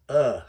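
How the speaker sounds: "uh" said as an isolated short vowel; demonstrated vowel. Shown as a demonstration. Teacher explains the spelling U H, demonstrated "uh". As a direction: give the bare "uh" sound. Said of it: "best connotated by U H".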